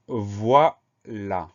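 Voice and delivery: "voilà" said very slowly, a pause between "voi" and "là"; découpé en syllabes. Shown as a demonstration. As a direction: dictate "voilà" syllable by syllable, drawn out. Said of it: "est composé de deux syllabes". (« voilà » articulé lentement, syllabe par syllabe)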